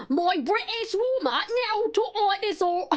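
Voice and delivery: hammed-up British, high and drawn out; mock British accent. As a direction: stretch out a silly British accent. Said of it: "Now you've had your British warm-up".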